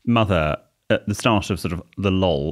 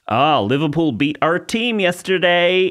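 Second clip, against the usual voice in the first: put-on slow, higher American accent; mock American airport officer, slow and high; 9 semitones higher, 5 dB louder, slower than usual. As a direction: put on a drawling Miami American voice. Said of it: "And he said to me, went".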